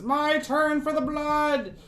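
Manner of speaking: high, loud, drawn-out demand; eager cultist cry. Acted. Cultist shoving an old woman aside for the blood, greedy cry. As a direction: call it out high, pushing to be next.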